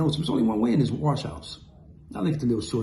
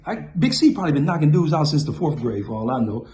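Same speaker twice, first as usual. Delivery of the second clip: ordinary talk, nothing marked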